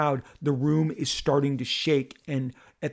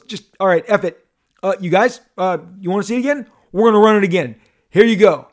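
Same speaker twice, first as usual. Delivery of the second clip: high, loud host giving in; loud announcing; 6 semitones higher, 12 dB louder, faster than usual. Retold or imitated. Hardwick to the crowd demanding a replay, loud announcing. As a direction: call it out to a packed hall.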